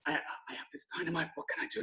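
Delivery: scared hushed plea; terrified whisper. Retold or imitated. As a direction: whisper it in panic, fast. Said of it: "sweating, terrified".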